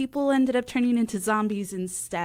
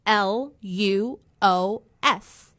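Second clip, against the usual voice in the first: spelling it out slowly; slower than usual. Shown as a demonstration. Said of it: "that is B like boy".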